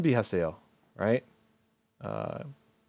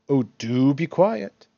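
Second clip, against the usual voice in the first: "do" drawn out, louder and higher; emphatic demand; 4 semitones higher, 6 dB louder than usual. Shown as a demonstration. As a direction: demand it slowly, leaning on "do". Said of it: "add emphasis to demands or requests".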